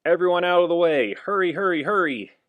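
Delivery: loud, high and fast shout; clearing the way. Acted. Firemen racing to the fire, shouting. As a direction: bark the street clear.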